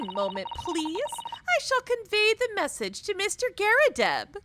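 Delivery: a meek housemaid taking a message; timid maid. Acted. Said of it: "That's an excellent maid voice".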